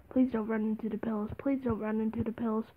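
quick, soft nervous plea; low-volume, hurried begging; hushed pleading. Real, not acted. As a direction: plead under your breath while hiding. Hiding, pleading not to be found.

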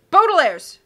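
high, loud shout of a name; calling out. A narrator's reading. Banker calling children already far off, shouting. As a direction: holler after someone leaving.

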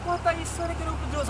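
mocking her. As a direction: mimic her making excuses. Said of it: "She's like".

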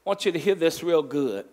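ordinary talk, nothing marked

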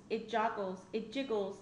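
usual speaking voice

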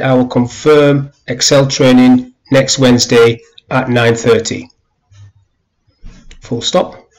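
slow, careful dictating voice; slow dictation with punctuation. Shown as a demonstration. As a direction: dictate the email clearly, commands included. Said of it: "I'll click it on".

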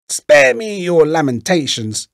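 put-on loud, high old-woman outcry; scornful witch's cry. A narrator's reading. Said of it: "cried the old woman".

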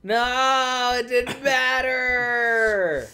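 loud, slow yell broken by a laugh; high laughing outburst; laughing shout. Real, not acted. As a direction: shout it out while cracking up. Yelling at game twist.

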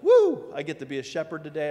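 mock-cheerful wake-up cry; mock excited shepherd. Preacher voicing an eager shepherd, mock excited.

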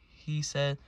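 ordinary delivery

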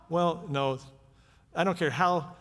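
usual speaking voice

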